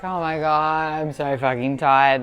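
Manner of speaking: slow, low, weary groan of a phrase; drained. Retold or imitated. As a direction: sag into it like you're spent. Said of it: "emotional or drained".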